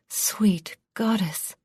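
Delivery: slow breathy whisper in awe; awed whisper over the baby; breathed. A narrator's reading. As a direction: barely breathe the words. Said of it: "she breathed".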